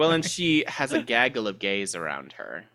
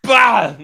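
imitated sneeze; 9 semitones higher, 9 dB louder than usual.

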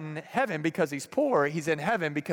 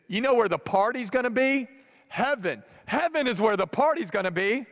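excited reveal; 6 semitones higher than usual. Acted. Pastor reveals good news.

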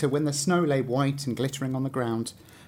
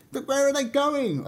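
much higher, worried question; anxious fir tree; 11 semitones higher than usual. A narrator's reading. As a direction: cry it out like a fretful little tree. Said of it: "asked the fir tree".

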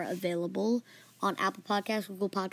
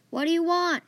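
higher-pitched answering shout; mild yell back; 8 semitones higher, 3 dB louder than usual. Acted. Friend answers the boy's call, mild yell back. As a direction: yell back without full force. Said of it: "He yelled back".

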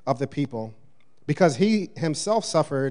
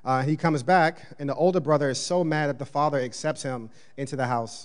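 normal talking voice

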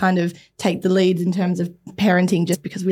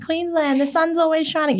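higher, mock-cheerful local boast; mocking Queenslanders; 9 semitones higher than usual. Mocking Queenslanders who claim constant sunshine, higher sing-song voice. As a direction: parody a smug Queenslander. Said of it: "all these Queenslanders now who are like".

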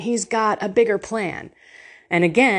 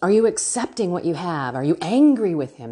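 usual speaking voice

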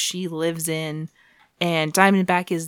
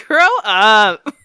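louder, exasperated with a laugh; louder retort; 3 semitones higher, 9 dB louder than usual. Real, not acted. Host repeats her retort to the show, louder and exasperated. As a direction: belt it out, fed up.

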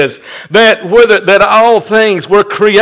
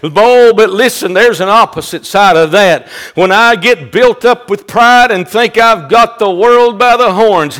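ordinary delivery

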